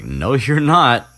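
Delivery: high, loud, drawn-out no; mock indignant refusal. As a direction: refuse with exaggerated disbelief. Acted quarrel, Ron refusing Hermione.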